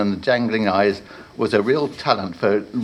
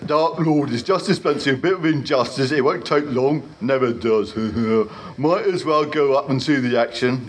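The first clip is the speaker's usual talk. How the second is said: higher, chuckling troll voice; chatty troll; 4 semitones higher than usual. Acted. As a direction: gossip like a cheerful troll guard.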